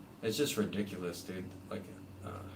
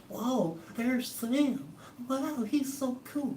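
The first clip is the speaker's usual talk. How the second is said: very high, slow gushing fan voice; mock starstruck fan; much slower than usual. Mocking fans gushing over Sam, very high and slow. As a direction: gush like a dazzled fan.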